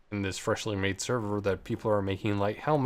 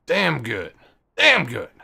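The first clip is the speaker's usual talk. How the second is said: emphatic damn good; 3 semitones higher, 8 dB louder than usual. Real, not acted. Repeats damn good louder.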